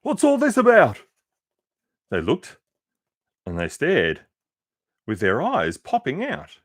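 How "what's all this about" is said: high, loud astonished call; the crowd shouts about the strange creature; shouting. Acted. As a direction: call out in amazement. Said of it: "the people came shouting".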